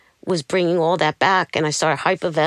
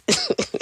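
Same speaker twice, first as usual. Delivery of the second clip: high laugh. Real, not acted. Laughs at own denial.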